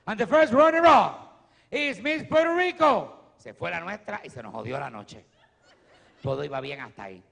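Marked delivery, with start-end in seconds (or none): 0.1-3.3 booming, slow, much higher announcer voice; grand, drawn-out emcee announcement; pageant announcer. Retold or imitated. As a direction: call out the runner-up like a pageant host on stage.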